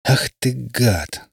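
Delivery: slow, strangled, choked-back curse. A narrator's reading. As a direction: force it through a tight throat. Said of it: "сказал придушенно".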